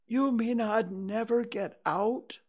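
high-pitched worried bear question; high, anxious character voice; dismayed bear. A narrator's reading. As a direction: ask it as a stuck little bear. Stuck Pooh asks in dismay.

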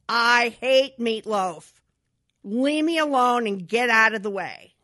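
loud, slow enraged outburst; angry husband. Retold or imitated. Husband throwing dinner at the wall, loud and furious. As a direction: bark it at her in fury.

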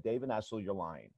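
a quiet, grave imitation of the coach; quiet grave accusation. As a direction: drop the voice low and level to accuse him. Coach calling out a lie.

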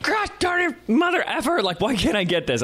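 high-pitched frustrated outburst, acted out; mock yell of exasperation, voice jumping way up; angry cussing. Frustrated student cussing into the coach's ear, acted angry yelling. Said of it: "cussing into his ear".

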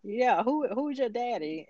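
a mock-swaggering Coach Boone; the coach taunting the quarterback, hammed up lightly; cocky coach. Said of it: "And then he was like".

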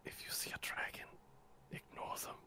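hushed secret warning; whispering. Acted. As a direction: whisper it conspiratorially.

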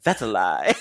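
flat, blunt accusing retort; accusatory. Retold or imitated. Girlfriend accusing the boyfriend of lying after a compliment. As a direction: snap back in disbelief. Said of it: "it just sounds so accusatory".

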